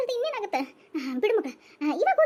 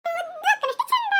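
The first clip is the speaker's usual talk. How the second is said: higher, slow and teary; teary sweet praise; 9 semitones higher, slower than usual. Acted. Little girl tearfully praising her big sister, slow and teary. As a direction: coax it out through tears.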